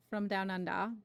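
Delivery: badly faked Australian accent; attempted Australian accent. Shown as a demonstration. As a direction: have a go at an Aussie accent. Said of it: "terrible, uh, attempt at her accent".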